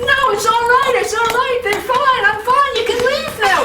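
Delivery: very high squeaky strained voice. Acted. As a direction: squeeze it up high in pain. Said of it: "His voice was getting higher and higher".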